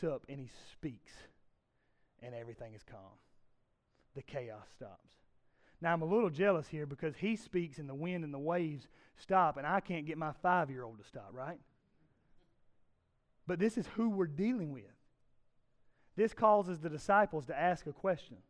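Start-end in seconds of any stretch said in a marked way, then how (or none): none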